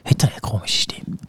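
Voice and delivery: hushed whispered question; whispering. Real, not acted. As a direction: whisper it, as if hiding a sore throat. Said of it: "weil du jetzt so flüsterst".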